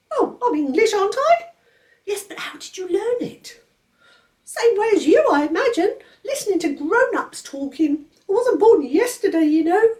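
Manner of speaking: put-on a severe baby laying down the law; stern baby George.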